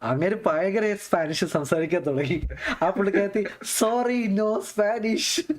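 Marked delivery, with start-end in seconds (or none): 3.8-5.5 high, slow, drawn-out apology; comically halting high-pitched imitation; mock apologetic stranger. Indian woman answering a Spanish speaker, mock helpless apology.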